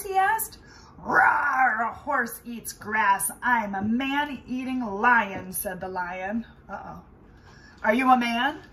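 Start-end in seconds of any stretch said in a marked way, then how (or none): 1.0-5.5 loud roaring lion voice. Acted. Lion roars at Roy. 7.8-8.6 loud roaring lion. Acted. Lion asks Roy.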